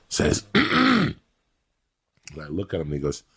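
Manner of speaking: normal talking voice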